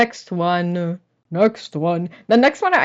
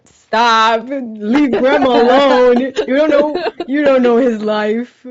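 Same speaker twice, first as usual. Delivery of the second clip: loud, higher-pitched mock protest; mock outrage; 4 semitones higher, 4 dB louder, slower than usual. Defending Grandma from the co-host's remark, mock outrage. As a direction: playfully yell in someone's defence.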